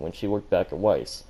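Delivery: ordinary delivery, nothing marked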